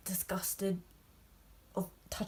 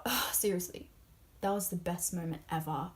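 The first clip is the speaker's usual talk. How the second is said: usual speaking voice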